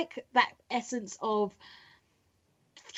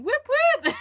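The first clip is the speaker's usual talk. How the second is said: much higher cheery whoop; 4 dB louder than usual. Pretend. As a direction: whoop it up high. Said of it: "me being professional".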